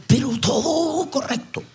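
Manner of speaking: high mocking voice, mimicking the prim scribes; a sneering, prissy little aside. Preacher mocking the scribes to his church, sneering.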